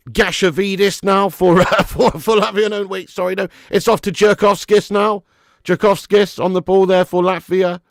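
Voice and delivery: lively live match commentary; play-by-play commentary. Acted.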